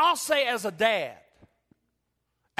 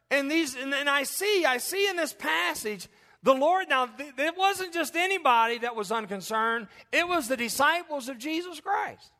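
fiery preaching; 6 semitones higher than usual. Acted. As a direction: drive it home to the congregation. Preaching at full force.

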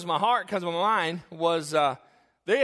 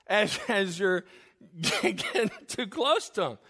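laughing through words; 3 semitones higher than usual. Real, not acted. Laughs while retelling the guide.

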